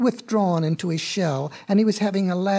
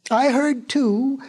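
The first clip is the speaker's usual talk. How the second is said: high, slow, drawn-out call; mild yell; 6 semitones higher, slower than usual. A narrator's reading. Crow backing up the chipmunk's joke, mild yell. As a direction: call it out with long vowels. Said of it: "yelled a crow".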